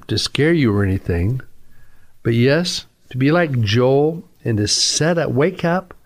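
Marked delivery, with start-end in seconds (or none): none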